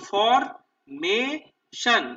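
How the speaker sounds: "Formation" in three slow, separate beats; split into syllables. Shown as a demonstration. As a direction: break "formation" into ফর, মে, শন, loudly. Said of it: "তিনটে সিলেবলে ভাগ করে নেবো". ("Formation" তিন সিলেবলে ভেঙে, ধীরে ধীরে)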